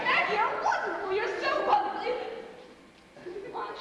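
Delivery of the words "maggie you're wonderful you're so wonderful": high, effusive thanks; shower the helper with delight; girlish gushing. Acted. Actress thanks Maggie for the travel help, gushing. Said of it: "your girlish squeals".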